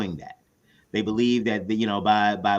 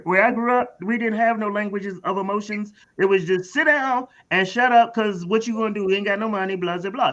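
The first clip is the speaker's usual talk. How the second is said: much higher, streetwise struggling parent; parent character voice; 10 semitones higher, 3 dB louder than usual. Retold or imitated. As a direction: restart the line fully in character. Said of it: "I'm sorry, excuse me".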